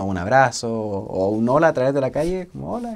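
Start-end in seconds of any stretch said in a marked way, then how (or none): 2.7-2.9 a light imitation of calling hello across the street; saludo gritado imitado. (saludo agudo, como gritando de lejos)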